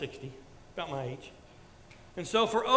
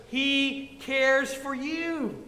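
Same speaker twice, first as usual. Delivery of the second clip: drawn-out, slow, higher promise; slow emphatic; 5 semitones higher, much slower than usual. Acted. Pastor stressing the promise to his church, slow and emphatic. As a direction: stretch each word out to the hearers.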